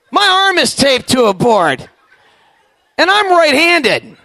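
slow, loud realization, climbing high at the end; milk the dawning horror; mock-alarmed realization; rising panic.

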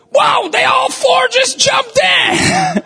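put-on high, slow, wide-eyed excited child; mock childlike wonder; excited kid voice. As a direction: squeal it like a thrilled little kid. Kid voice for grandkids jumping.

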